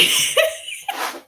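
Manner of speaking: a sudden high, airy burst of laughter; high breathy laugh. Real, not acted. Mom laughs at how she panicked on the highway, high and airy. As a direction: laugh at her own panic. Laughing while telling story.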